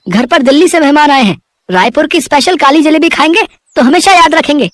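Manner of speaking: a delighted buyer raving over the sweets; excited customer. Acted. Customer voiced at the stall.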